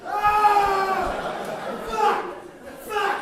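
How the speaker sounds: very high strained shrieks; high shrill bear cursing the stream; frustrated bear yelling. Acted. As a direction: screech like an angry bear flailing at water.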